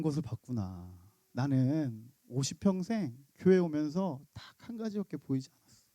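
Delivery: soft, low, gentle mother; tender answer. Retold or imitated. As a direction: hush the daughter with love. (딸에게 미소 지으며 부드럽고 낮게)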